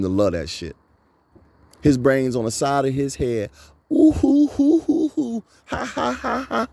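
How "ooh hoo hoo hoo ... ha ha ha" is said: whooping high laughter; high hooting laugh. Real, not acted. Laughing hard at his own joke.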